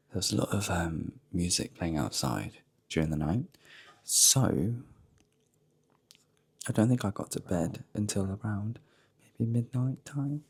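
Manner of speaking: hushed early-morning voice; quiet at night. Real, not acted. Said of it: "I'm gonna be quite quiet on my way down".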